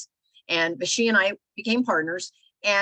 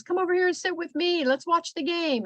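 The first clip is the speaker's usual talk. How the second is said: mocking husband's call; 11 semitones higher than usual. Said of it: "and says".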